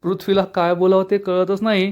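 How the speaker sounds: usual speaking voice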